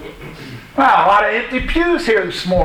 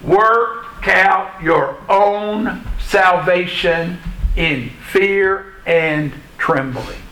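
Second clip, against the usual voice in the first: slow solemn scripture; much slower than usual. Real, not acted. Quoting scripture slowly for weight.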